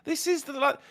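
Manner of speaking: high, gentle, dreamy mock plea; mock hippie plea. Mocking internet free speech, a high dreamy hippie plea. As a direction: float it out like a blissed-out hippie.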